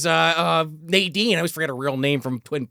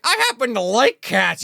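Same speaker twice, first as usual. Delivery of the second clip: mock Angela Bassett; 6 semitones higher than usual. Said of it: "she's like".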